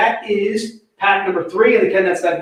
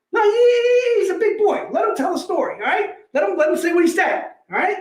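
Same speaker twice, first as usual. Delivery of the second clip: second Goodfellas character; 10 semitones higher than usual. Acted. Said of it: "let me give you some Goodfellas".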